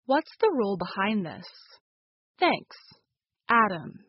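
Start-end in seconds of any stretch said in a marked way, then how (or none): none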